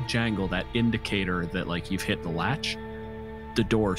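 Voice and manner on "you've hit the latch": ordinary delivery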